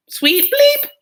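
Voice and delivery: "Bleep" shot way up high and loud; high, loud mock bleep on "Bleep"; censor bleep.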